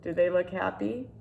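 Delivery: usual speaking voice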